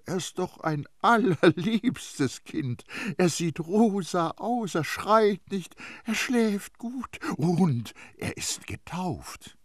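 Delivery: high, slow, doting voice; drawn-out, sing-song coaxing; cooing. A narrator's reading. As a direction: coo over a baby to win her round. Priest cooes over baby to persuade.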